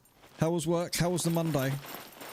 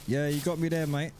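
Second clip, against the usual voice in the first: ordinary talk, nothing marked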